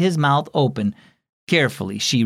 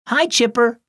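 put-on little girl voice; 9 semitones higher than usual. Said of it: "she said".

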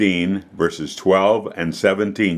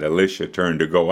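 plain reading voice

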